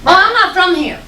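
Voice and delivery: ordinary talk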